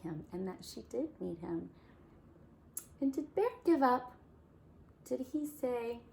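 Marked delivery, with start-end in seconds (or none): 3.0-6.0 lively question. Real, not acted. Teacher asking children.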